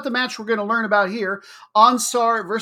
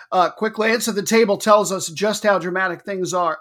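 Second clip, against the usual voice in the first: ordinary talk, nothing marked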